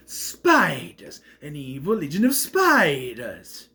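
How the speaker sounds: slow, drawn-out mock-dramatic voice; hammed-up menace. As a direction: stretch out "spiders" with theatrical relish. Hammed-up announcement of the rolled creatures.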